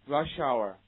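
"Rush hour" joined as one word, sh running into the vowel; loud model of "Rush hour" without a gap; linked reading. Shown as a demonstration. Teacher drilling a phrase confused with shower, linked reading. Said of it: "read after me".

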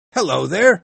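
high, loud call down to the street. Acted. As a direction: hail a boy from the window. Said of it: "he called to a boy".

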